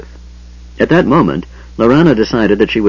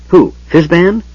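put-on confused old man; 9 semitones higher, 4 dB louder than usual.

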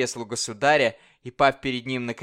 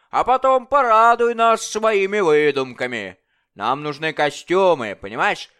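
loud, high, mocking tyrant king; bully the dwarf like a drunken king; boisterous royal bully demanding fun; 4 semitones higher, 6 dB louder than usual. King voiced in story.

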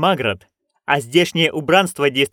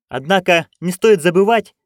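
louder, higher insistence; insist, louder and higher; emphatic; 5 semitones higher, 4 dB louder than usual. Acted. Magrat raises objection. (настойчиво, громче и выше)

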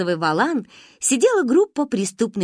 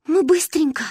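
put-on high little girls; 9 semitones higher, 4 dB louder than usual. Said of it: "обещали мы".

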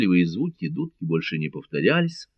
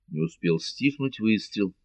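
usual speaking voice

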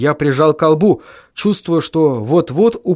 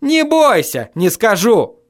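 high, heated retort; raised-pitch shouted reply; shouting back; 8 semitones higher, slower than usual. A narrator's reading. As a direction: cry it back sharply high. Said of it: "крикнул я в ответ".

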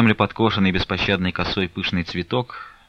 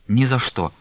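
vehement refusal, getting higher and louder; sharp, desperate outburst; crying out; 5 semitones higher, 3 dB louder than usual. A narrator's reading. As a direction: cry out in defiance. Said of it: "вскрикнула Настя".

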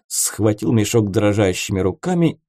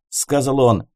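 usual speaking voice

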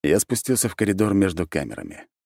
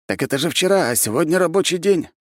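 a higher character voice defending himself; higher-voiced protest; 12 semitones higher, 3 dB louder than usual. A narrator's reading. Mark justifies coming to work after his wedding, high and insistent.

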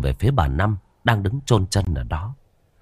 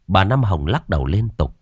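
ordinary delivery, nothing marked

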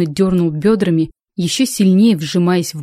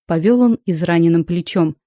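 usual speaking voice

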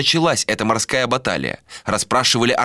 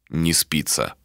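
low, quieter falling reply; low flat answer; 5 semitones lower, 4 dB quieter, slower than usual. Acted. Ishtar echoes Malika's question.

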